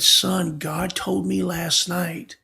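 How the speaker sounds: slow, crying through the words; tearful. Real, not acted. Son crying as he quotes his dying mother, slow and tearful. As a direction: choke up as you quote her.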